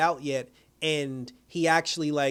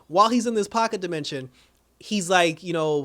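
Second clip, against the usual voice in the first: ordinary delivery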